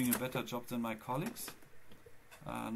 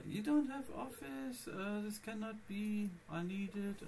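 whiny; 7 semitones higher, slower than usual. Retold or imitated. Mimics accountant again.

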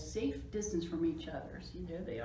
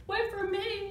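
very high, louder plea; frightened shriek; 13 semitones higher, 5 dB louder, slower than usual. A narrator's reading. Scared Spike running after Splat, shrieking. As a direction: call out in mock panic. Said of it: "Spike shrieked".

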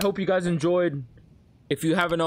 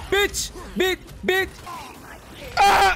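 angry yelling; 11 semitones higher, slower than usual. Real, not acted. Raging at boss.

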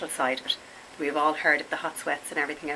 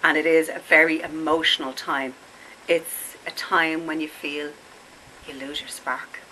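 ordinary talk, nothing marked

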